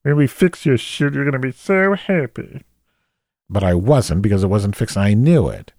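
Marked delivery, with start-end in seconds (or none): 0.0-2.6 high, gushing fake cheer; hammed-up customer service delight; overexcited call-center agent. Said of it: "in this hip language, like".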